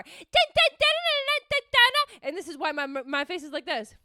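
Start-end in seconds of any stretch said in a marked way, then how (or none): 0.0-2.3 high, loud sung alarm noises; frantic dun-dun sting. Acted. Student freaking out in her head, frantic dun-dun noises. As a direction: blare out a panicky dramatic sting.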